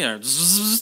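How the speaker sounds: normal talking voice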